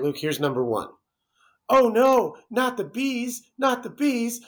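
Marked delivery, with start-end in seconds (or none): 1.7-2.4 high, screaming panic, crying out; hammed-up bee panic. Acting the Wicker Man bees scream. 2.5-3.4 mock panic. Quote repeated twice. 3.6-4.4 mock panic. Quote repeated twice.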